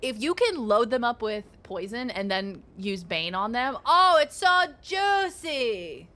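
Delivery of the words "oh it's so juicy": savouring drawl. Acted. Savouring the poison combo.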